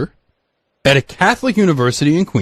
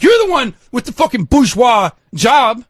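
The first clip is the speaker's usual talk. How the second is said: fuming retold rant; 8 semitones higher than usual. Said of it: "I was fucking fuming".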